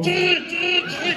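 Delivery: high-pitched alarm noise made by mouth; mock warning signal, shrill and repeated; imitated alarm. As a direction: chirp like a siren going off. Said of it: "como diciendo, Alarma, alarma".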